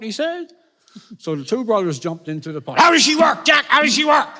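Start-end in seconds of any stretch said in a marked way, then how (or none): 2.8-4.3 high, loud excited calling; eager shouting. Acted. Two brothers in the punt to Jack, eager shouting. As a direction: holler it over to Jack, pitched up.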